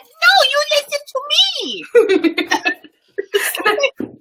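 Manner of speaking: put-on high, childlike sassy echo; mocking toddler. Mother imitating her son mocking her: high kid voice. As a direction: toss the line back like a defiant little boy. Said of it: "And he'll be like".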